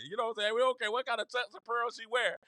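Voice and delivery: ordinary delivery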